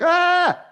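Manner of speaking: a high, wired "Ah" of jittery energy; hyped-up yelp. Retold or imitated. Speaker mocking the wrong kind of energy with a high wired yelp. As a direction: blurt it like someone buzzing on caffeine. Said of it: "Not energy like".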